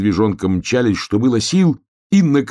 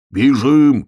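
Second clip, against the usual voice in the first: raised, louder urgent cry; shouting; 6 semitones higher, 6 dB louder than usual. A narrator's reading. Bear cub tells hedgehog to run, shouting. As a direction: shout it out to get them running. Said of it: "крикнул медвежонок".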